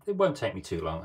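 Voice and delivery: normal talking voice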